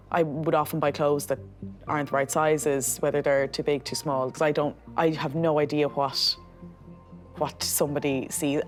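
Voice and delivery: usual speaking voice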